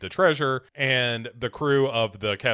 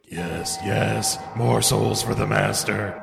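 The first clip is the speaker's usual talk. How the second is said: evil monkey voice; slower than usual. Retold or imitated. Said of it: "monkey is like".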